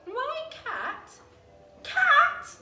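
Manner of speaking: high, rising to a loud squawk; alarmed squawk. A narrator's reading. Frightened creatures squawking at the cat. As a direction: shriek the word in fright.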